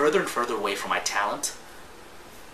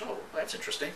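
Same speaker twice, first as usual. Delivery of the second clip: higher, breathless whispered aside; mock impressed whisper; 7 semitones higher, 12 dB quieter than usual. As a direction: whisper it like a young man secretly impressed. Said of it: "I was like".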